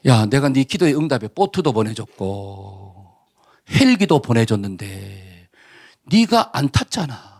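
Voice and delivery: low, slow, patient rebuke; God's reply. Acted. God scolds the drowned man who refused rescue, low and slow. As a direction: speak as God gently scolding a foolish man.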